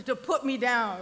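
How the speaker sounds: ordinary talk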